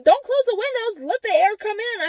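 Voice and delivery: high, loud mock protest; high-pitched argumentative retort, retold; insistent protest. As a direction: imitate someone objecting loudly. Retold argument over windows.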